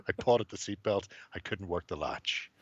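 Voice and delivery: ordinary talk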